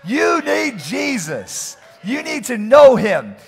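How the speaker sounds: loud, fervent preaching build, drawn out at the peaks; preaching at full force. Acted. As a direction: preach it hard to the church.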